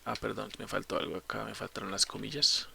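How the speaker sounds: soft self-correction under the breath; quiet aside. Real, not acted. Instructor noticing missing quotes while typing, quiet aside. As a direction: drop the volume, as if talking to yourself.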